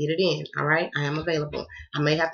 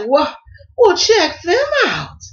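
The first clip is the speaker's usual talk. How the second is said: impressed; 11 semitones higher, 10 dB louder, slower than usual. Retold or imitated. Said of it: "I was like".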